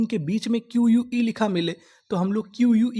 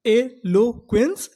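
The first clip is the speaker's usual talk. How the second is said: Eloquence slowed and a bit louder; slow emphatic word; 3 semitones higher, 4 dB louder, much slower than usual. Shown as a demonstration. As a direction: announce eloquence slowly to the class. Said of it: "उन्हें हम लोग क्या बोलते हैं?". (धीरे और ज़ोर से)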